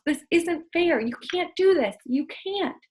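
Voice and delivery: high, loud, pleading outcry; a boy shouting his unfairness at the house; yelling protest. Acted. Harvey yelling up at the house, high pleading shout. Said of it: "Harvey yelled up at the house".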